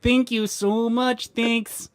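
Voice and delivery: high, slow ecstatic thank you; mock ecstatic thank you. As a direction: gush thanks in fake ecstasy. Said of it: "clearly saying".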